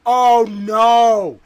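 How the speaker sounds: a real alarmed outburst; panicked. Real, not acted. Panicked reaction.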